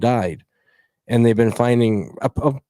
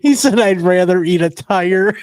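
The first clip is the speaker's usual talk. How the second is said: laughing; 7 semitones higher, 5 dB louder than usual. Retold or imitated. Laughing repeat of friend's line.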